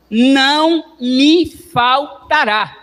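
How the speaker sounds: high, loud, very slow; fervent climax. Acted. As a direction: lift it to a climax. Climax of psalm.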